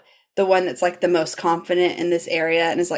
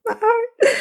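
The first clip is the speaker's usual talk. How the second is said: tiny, very high squeak; touched squeal. Real, not acted. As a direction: squeal it, heart melting. Repeats it, touched by the scene.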